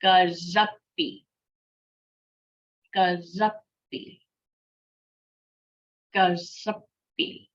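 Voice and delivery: "Gazuppy" whole, with the middle consonants held; joined-up word. Shown as a demonstration. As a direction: run "Gazuppy" together, holding the double letters. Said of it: "full speed".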